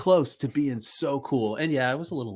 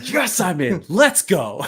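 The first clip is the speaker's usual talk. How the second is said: excited; 8 semitones higher, 6 dB louder, slower than usual. Retold or imitated. Excited wrestler quote.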